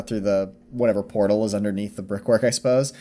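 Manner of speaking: ordinary talk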